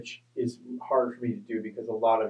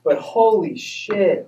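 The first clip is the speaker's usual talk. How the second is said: exasperated; 8 semitones higher, 7 dB louder, much slower than usual. Real, not acted. Exasperated outburst.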